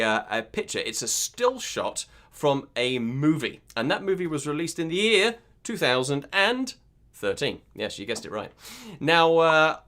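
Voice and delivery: normal talking voice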